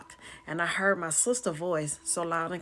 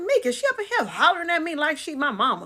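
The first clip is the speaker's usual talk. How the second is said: high, loud, worked-up protest; indignant complaint; 10 semitones higher, 5 dB louder than usual. Retold or imitated. Complaining to relatives about her sister, indignant. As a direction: complain loudly, still heated from arguing.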